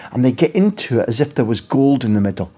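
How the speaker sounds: ordinary talk, nothing marked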